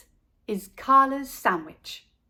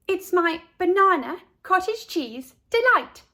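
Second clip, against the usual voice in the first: put-on proud girl; 8 semitones higher, 5 dB louder than usual. A narrator's reading. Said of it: "said Carla".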